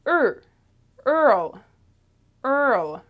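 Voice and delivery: "er" and "earl" as isolated, drawn-out vowel examples; vowel demonstration. Shown as a demonstration. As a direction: say "er", then "earl", holding the vowel. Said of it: "It's very similar".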